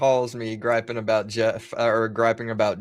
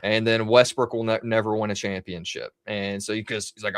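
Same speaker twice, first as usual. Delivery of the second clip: usual speaking voice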